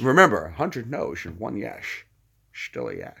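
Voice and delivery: slushy Scottish advice sinking very low; low Scottish film-star slur; Sean Connery. Acted. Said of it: "Sean Connery is a little more like this".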